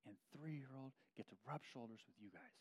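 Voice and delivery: hushed, near-whispered with emotion; choked up, barely voiced; getting emotional in front of the church. Real, not acted. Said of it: "I'm getting a little emotional as I say that".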